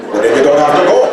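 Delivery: loud, high, fast demanding father; indignant grandfather. Retold or imitated. Grandfather scolding his son, loud and high. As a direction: push back hard at the son. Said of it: "father voiced".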